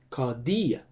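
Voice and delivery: Cardia with the tone rising on the final syllable; stress on last syllable. Shown as a demonstration. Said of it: "the higher tone will be on the ultima".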